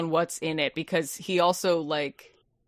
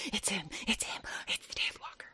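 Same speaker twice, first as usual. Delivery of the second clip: hushed, breathy mock whisper; frightened whisper; 16 dB quieter than usual. Joking about the henchman, scared whisper.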